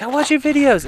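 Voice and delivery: high, loud fan's holler; acting out an excited viewer; imitated eager fan. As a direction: call it out high and loud, like a fan recognising someone. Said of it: "and he's like".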